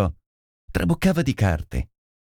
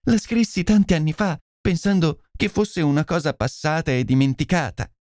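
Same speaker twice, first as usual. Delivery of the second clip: higher-pitched lady explaining herself; distressed confessing lady; 7 semitones higher than usual. Acted. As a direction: confess anxiously, appealing for understanding. (signora turbata che si confessa)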